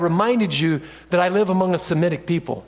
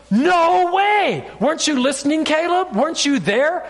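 crowd shouting back; 7 semitones higher than usual. Acted. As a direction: argue back at Caleb in disbelief. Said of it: "you've got the people over here going".

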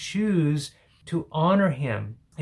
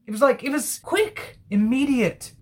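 much higher, louder, rushing confession; amazed psalmist; 7 semitones higher, 4 dB louder, faster than usual. Acted. Psalmist telling God he held his sin, high and fast. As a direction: pour it out like a forgiven man. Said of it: "it's almost like stammering".

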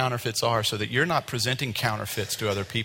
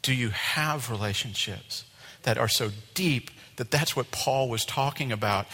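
ordinary talk

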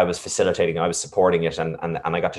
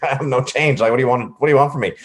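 higher, lightly acted protest; retold exasperated comeback, pitched up; mock exasperation; 7 semitones higher, 4 dB louder, faster than usual. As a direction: imitate a shop worker helplessly protesting. Acting out the unhelpful shop worker.

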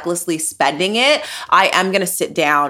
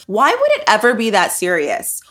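ordinary delivery

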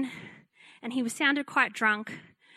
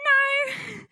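timid little voice; 12 semitones higher, 4 dB louder than usual. Retold or imitated. Young pastor answering a threatening drunk caller, timid childlike squeak. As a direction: sound like a scared five-year-old. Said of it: "I still have a fairly high-pitched voice".

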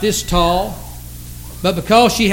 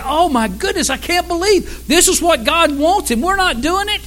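distressed disbelief; 10 semitones higher than usual. Retold or imitated. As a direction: voice the crowd's stricken realization, pitched high. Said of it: "And they said".

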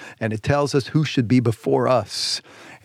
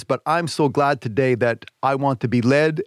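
normal talking voice

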